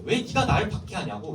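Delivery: a firm, pressing question; strong demand. Acted. Teacher voicing Jesus confronting Saul, firm and pressing. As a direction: push the why hard at him. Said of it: "이렇게 강하게 묻고".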